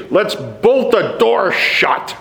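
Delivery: high, drawn-out panicked quote; mock alarm. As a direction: imitate townsfolk in a hammed-up scare. Imagined terrified townspeople reacting.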